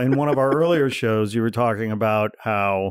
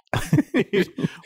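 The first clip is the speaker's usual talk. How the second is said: real whisper; 9 semitones higher than usual. Real, not acted. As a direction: whisper privately into dad's ear. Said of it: "Whispering into my ear".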